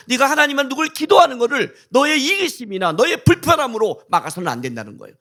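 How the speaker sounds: loud, high sermon climax; a pastor thundering the point at his congregation; fervent preaching. Real, not acted. Preaching at full force.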